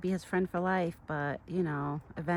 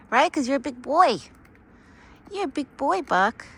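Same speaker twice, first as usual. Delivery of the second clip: much higher, louder praise for a pet; pet talk; 8 semitones higher, 8 dB louder than usual. Real, not acted. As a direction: cheer the animal on like a proud owner. Praising Buck as big boy.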